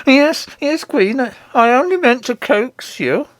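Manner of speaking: high, put-on voice of a cowed husband; flustered goblin king; placating king. A narrator's reading. King placates the attacking Queen.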